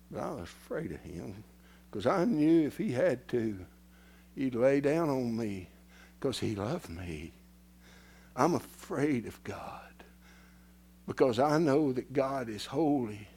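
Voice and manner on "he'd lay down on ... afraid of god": usual speaking voice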